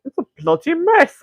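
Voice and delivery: high, hammed-up British accent on bloody mess; jokey British voice for the day; mock British accent. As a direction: play a cartoon Brit saying it. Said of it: "our accent for the day?".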